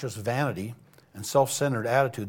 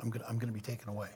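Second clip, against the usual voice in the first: quiet, intimate; 3 semitones lower, 12 dB quieter, faster than usual. A narrator's reading. Jesus gently telling disciples he'll leave.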